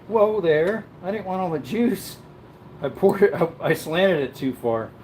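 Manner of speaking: usual speaking voice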